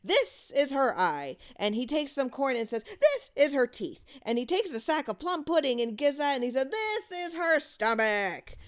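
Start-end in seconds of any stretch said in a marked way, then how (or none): 0.0-1.4 mock spooky announcement. Father plays the witch game. 2.8-4.0 mock spooky announcement. Father plays the witch game. 6.7-8.6 mock spooky announcement. Father plays the witch game.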